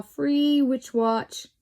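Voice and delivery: slow, drawn-out salesy read, lightly voiced; mock ad pitch. Reading a witch catalogue to viewers, slow mock ad voice. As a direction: sell the free witch watch like a hammed-up advert.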